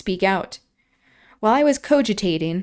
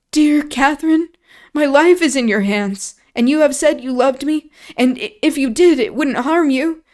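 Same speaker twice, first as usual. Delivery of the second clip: desperate pleading; 5 semitones higher, 5 dB louder than usual. Acted. Linton begging Catherine in terror.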